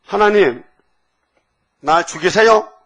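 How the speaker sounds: acted-out indignant appeal, pushed high; aggrieved plea. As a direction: imitate a wronged pastor protesting to heaven. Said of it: "억울하잖아요".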